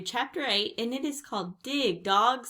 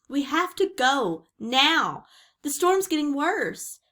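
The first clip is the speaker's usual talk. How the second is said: higher, louder, insistent voice; urgent plea; 7 semitones higher, 7 dB louder than usual. A narrator's reading. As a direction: press someone to leave at once. Urging Jack to flee the storm.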